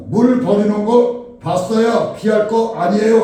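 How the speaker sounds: loud, high argumentative yell; shouted retort. Retold or imitated. Quarrel over spilled water, shouted retort. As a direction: shout back to win the quarrel. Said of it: "소리를 지른 겁니다".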